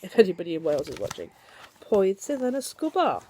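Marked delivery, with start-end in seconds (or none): 1.9-3.2 put-on reading to a child. A narrator's reading. Reading Welsh question to child.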